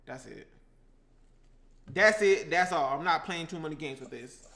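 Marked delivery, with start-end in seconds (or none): 0.0-0.5 very soft, low aside; quiet. Real, not acted. Cook adding a little tahini, quietly to self. First take, muttered to self. 1.9-3.0 loud, firm declaration. Real, not acted. Cook refuses to add more tahini, firmly. Repeats it firmly, stops adding.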